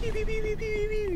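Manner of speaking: very high mock blaster sounds; pew-pew gun noises. Gamer mocking enemy archers shooting at him, high pew-pew noises. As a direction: chirp out toy laser shots.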